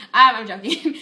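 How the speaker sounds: laughing it off; joking through a laugh. Real, not acted. Laughs after joking insult.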